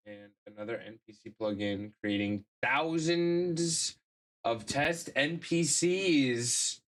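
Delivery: ordinary talk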